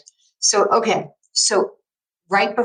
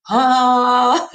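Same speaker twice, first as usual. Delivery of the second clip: high, loud awestruck gasp; awed gasp; 7 semitones higher, 6 dB louder than usual. Retold or imitated. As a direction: let out a big Oprah-moment ah. Oprah moment on seeing Ali.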